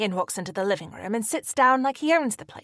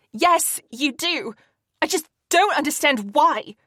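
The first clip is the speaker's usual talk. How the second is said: louder, rising anger; growing angrier; 6 semitones higher, 5 dB louder than usual. A narrator's reading. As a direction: push the accusation harder. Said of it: "becoming more angry".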